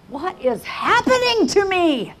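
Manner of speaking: high, drawn-out cry of disbelief; overwhelmed high wail; bewildered outcry. Retold or imitated. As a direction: cry it out, swelling on HAPPENING.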